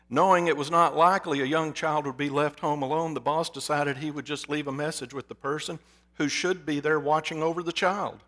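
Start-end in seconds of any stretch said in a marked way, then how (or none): none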